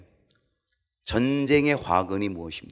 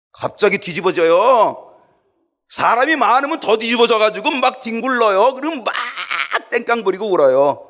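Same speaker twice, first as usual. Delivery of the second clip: fervent preaching; 13 semitones higher, 10 dB louder than usual. Acted. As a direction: paint the tantrum loudly. Preaching at full force.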